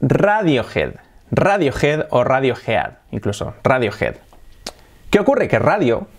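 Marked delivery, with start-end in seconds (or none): 0.0-4.1 "Radiohead" as RA-dio-jed, rolled R; Spanish-style ra-dio-HED with raspy j; Spanish pronunciation. Shown as a demonstration. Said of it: "aquí en España se pronuncia".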